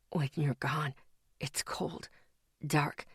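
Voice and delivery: low, very soft fading whisper. Acted. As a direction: whisper it hollowly from far away. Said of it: "he whispered".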